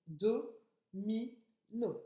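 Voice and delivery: mot découpé en syllabes bien séparées; syllabe par syllabe. Shown as a demonstration. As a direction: dicter "Do, mi, no" avec une pause après chaque syllabe. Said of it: "Je te laisse compter les syllabes". (en détachant chaque syllabe)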